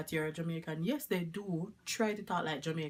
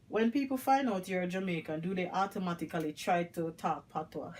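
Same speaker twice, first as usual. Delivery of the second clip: ordinary talk, nothing marked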